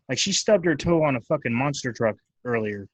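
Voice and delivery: ordinary talk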